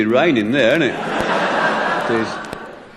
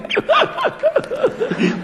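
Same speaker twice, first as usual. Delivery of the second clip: very high mock giggle; imitated chuckling. DJ listening to the record, high imitated chuckle. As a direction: imitate someone chuckling away helplessly. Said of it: "he was chuckling away".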